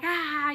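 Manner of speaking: a short, hammed-up scream; mock movie scream. Shown as a demonstration. Showing how women scream in movie births, mock scream. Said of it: "I wasn't screaming".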